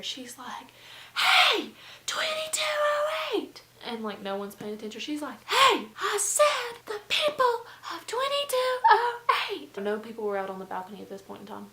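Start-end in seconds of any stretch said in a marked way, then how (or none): 1.2-3.6 high-pitched full-volume holler; yelling down. Retold or imitated. Roommate yelling down to the noisy apartment below, loud high shout. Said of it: "She's yelling down there". 5.4-9.8 very high, drawn-out holler; top-of-lungs yelling. Retold or imitated. Roommate calling out the people downstairs, high lung-busting yell. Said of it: "yelling at the top of her lungs".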